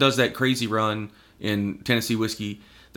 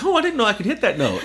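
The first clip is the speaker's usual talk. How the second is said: mock amazement; 11 semitones higher than usual. Marvelling at his own high scream.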